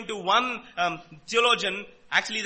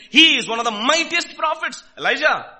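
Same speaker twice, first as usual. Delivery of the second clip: higher, louder voice of an amazed crowd; raised, emphatic quoted exclamation; awed proclamation; 4 semitones higher, 9 dB louder than usual. Retold or imitated. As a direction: proclaim it as an impressed onlooker. Voicing the crowd's awed verdict.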